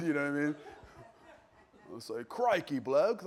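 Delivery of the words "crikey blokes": put-on Aussie exclamation; Australian accent. Preacher imagining Bible figures after the flood, mock Australian. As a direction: exclaim it like an Australian crocodile hunter. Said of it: "they become Australian in my mind".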